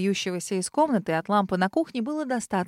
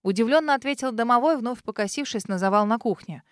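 plain reading voice

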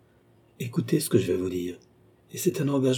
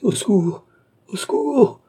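high, loud, panicked call; shrieked plea for rescue; cry for help; 13 semitones higher, 5 dB louder than usual. A narrator's reading. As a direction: call out in alarm, pitch soaring. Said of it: "cria André".

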